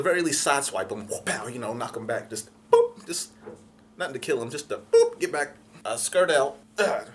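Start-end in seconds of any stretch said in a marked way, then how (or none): 2.3-3.0 high squeaky boop sound; comic boop. Retold or imitated. Sound effect of car bump. 4.5-5.2 high squeaky boop; cartoon bump noise; comic boop. Sound effect of car bump.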